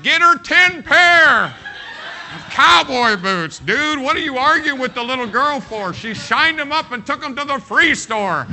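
put-on loud and laughing, buy the girl the boots, dude; loud amused protest to the dad; exasperated shout. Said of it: "I'm like".

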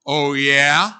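loud defiant oh yeah, rising; chip on his shoulder. Shown as a demonstration. Preacher teaching the congregation a defiant reply, loud and cocky. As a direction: throw it back like a dare. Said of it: "with a little bit of a chip on your shoulder".

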